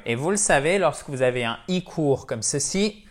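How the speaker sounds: ordinary talk, nothing marked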